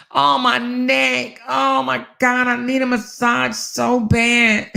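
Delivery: high, slow mock whine; hammed-up complaining wife; mock whining. Mocking herself moaning about her neck, high and slow. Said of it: "I ain't gotta sit there and be like".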